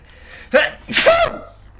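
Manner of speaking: loud sudden real sneeze; sneezing. Real, not acted. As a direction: let out a big sneeze. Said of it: "I think I'm gonna sneeze again".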